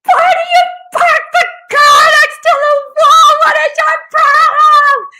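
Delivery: loud, very high furious yelling; hammed-up screaming outburst; swearing rant. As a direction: scream at the driver in mock rage. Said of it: "I was just swearing".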